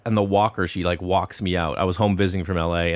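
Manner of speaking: ordinary talk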